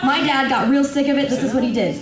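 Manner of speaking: usual speaking voice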